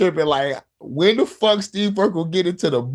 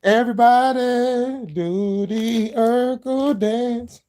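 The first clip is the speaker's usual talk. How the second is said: Urkel hype call; 7 semitones higher, much slower than usual. Retold or imitated. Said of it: "motherfuckers in the corner doing the Urkel dance".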